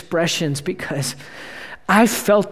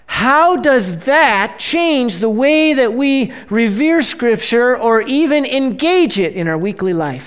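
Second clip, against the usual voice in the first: ordinary talk, nothing marked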